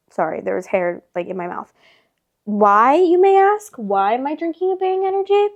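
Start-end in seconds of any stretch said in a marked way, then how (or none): 2.4-5.5 high playful rhetorical ask; mock question. Real, not acted. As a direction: pose the question theatrically.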